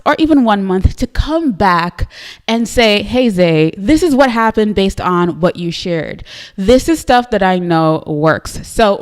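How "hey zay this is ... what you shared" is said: normal talking voice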